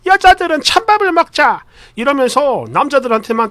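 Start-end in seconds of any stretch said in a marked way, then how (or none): 0.0-1.6 high-pitched mock older woman; mother-in-law's voice. Mother telling the women to eat cold rice, high put-on voice. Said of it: "전에 저희 어머니가요".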